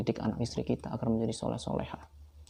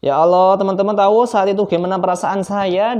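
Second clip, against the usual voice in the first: exasperated cry; 7 semitones higher, 11 dB louder than usual. Real, not acted. Recalling his despair.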